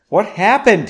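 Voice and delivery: loud, high, desperate question. A narrator's reading. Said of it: "Henry asked more desperately".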